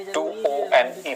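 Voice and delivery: ओ एन ई read letter by letter, slowly; spelled out letters. Shown as a demonstration. Teacher spelling the ketone ending for the class, slowly. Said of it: "ओन, ओ एन ई".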